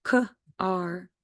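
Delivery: K-R as short "kuh", pause, long "ar"; sounded out. Shown as a demonstration. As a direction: say K-R as two separate sounds. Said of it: "make that R sound".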